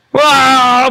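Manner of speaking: loud, high exclamation of swelling voices. Retold or imitated. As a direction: imitate voices flaring up in an argument. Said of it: "목소리가 막 커져요".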